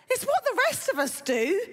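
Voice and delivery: put-on high-pitched mum in an Essex accent. Mum answering her daughter Rose, Essex accent. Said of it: "And her mum says".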